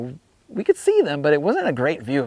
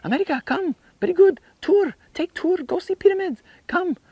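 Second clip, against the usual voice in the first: much higher, eager Egyptian guide in broken English. Retold or imitated. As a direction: pitch a street tout's broken Egyptian English way up high. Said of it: "in his broken English, and he's like".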